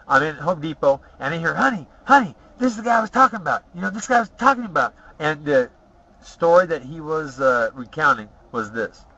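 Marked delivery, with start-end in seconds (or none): 1.5-4.9 high, fast, excited voice of a woman calling her husband. Retold or imitated. A woman in Home Depot tells her husband she spotted him, high and fast. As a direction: rush it out high like an eager fan who just spotted him.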